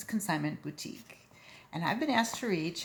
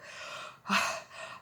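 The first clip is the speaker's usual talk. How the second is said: tired sigh; 4 dB quieter than usual. Acted. Panting up the steps.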